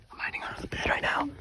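whispering. Real, not acted. Hiding during hide and seek.

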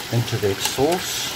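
ordinary delivery

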